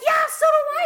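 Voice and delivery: high, loud imitation of husband snapping back; retold yell back. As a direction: fire back like an angry husband. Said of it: "We're yelling at each other".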